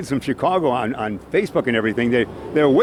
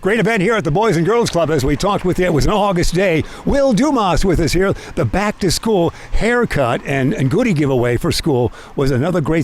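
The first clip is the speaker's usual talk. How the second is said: news anchor; 3 semitones higher, 3 dB louder than usual. Acted. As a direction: announce it like a local news anchor. News anchor segment intro.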